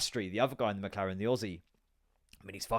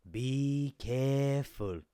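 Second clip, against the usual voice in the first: put-on slow, stretched Polish-accented warning; a Polish nursery lady saying be careful, slowly; much slower than usual. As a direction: mimic a Polish preschool teacher's slow warning. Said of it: "obviously has an accent".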